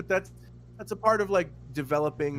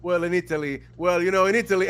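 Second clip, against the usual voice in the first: louder, bragging Italian type; pompous Italian; 7 dB louder than usual. Retold or imitated. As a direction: puff up like a braggart. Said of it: "a certain kind of Italian".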